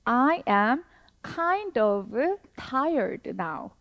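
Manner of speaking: slow, careful English example; slow model reading. Shown as a demonstration. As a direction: spell out each word slowly. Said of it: "라고 하는 거예요". (천천히 또박또박 읽는 예문)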